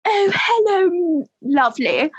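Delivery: put-on slow, high, sing-song posh English accent; mock English accent; classic English accent. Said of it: "the classic English accent".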